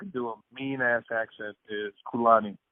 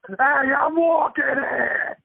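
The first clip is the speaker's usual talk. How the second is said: very loud, high New York holler; hammed-up, stretched New York street yell; New York accent; 14 semitones higher, 9 dB louder, much slower than usual. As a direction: bellow it slowly like an angry New Yorker. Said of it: "New York, it's like".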